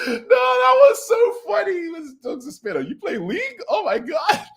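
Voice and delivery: real laughing fit; laughing hard. Real, not acted. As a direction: shriek with laughter. Cracking up over the joke.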